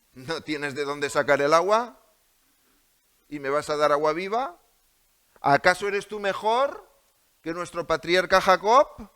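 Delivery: defiant woman challenging Jesus. Acted. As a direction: throw the questions back defiantly.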